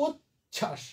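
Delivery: উচ্ছ্বাস split in two, উচ then ছাস; syllable by syllable. Shown as a demonstration. Teacher pointing out the example, syllable by syllable. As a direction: stretch উচ্ছ্বাস with a break between its syllables. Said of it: "একইভাবে".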